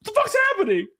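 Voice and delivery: very high, strained exasperated voice; shriek-rant in mock disbelief. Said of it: "I'm like".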